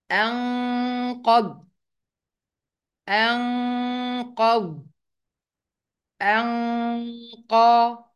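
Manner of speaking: "أنقض" with the n drawn out as a level nasal hum; hidden noon with ghunnah. Shown as a demonstration. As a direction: hold a long nasal hum before the qaf in "أنقض". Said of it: "الغنة اللي هي الصوت اللي بيطلع من المناخير".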